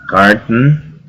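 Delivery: "carton" said as "cart-n", T running straight into N; O dropped in carton. Shown as a demonstration. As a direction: leave out the O in "carton", rising at the end. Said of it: "omitir un poco lo que sería el sonido de la O".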